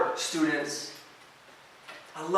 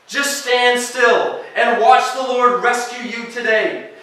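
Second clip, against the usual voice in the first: loud, driving sermon delivery, much higher and louder; full-force preaching; 8 semitones higher, 10 dB louder than usual. Acted. Preacher urging students, full-force preaching. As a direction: preach it out to the whole room, pushing every line.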